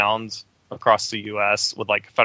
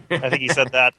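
usual speaking voice

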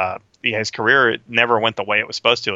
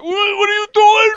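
Harvey Keitel impression; 7 dB louder than usual. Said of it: "Keitel was like".

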